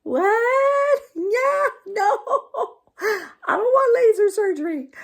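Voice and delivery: high, loud panicked refusal; shrill no-way outburst; alarmed protest. Retold or imitated. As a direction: recoil in mock horror. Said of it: "And I was like".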